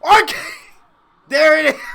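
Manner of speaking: loud, delighted yell at the screen; thrilled shout of there it is; excited shout. Real, not acted. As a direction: call out the move like a fan who predicted it. Calls the wrestling move live.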